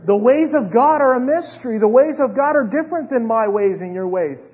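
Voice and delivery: high-energy sermon cadence; impassioned preaching. Real, not acted. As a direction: proclaim it fervently to the congregation. Preaches at full force.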